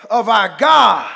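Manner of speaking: full-force shouted preaching; rising sermon shout. Acted. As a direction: preach it out to the congregation.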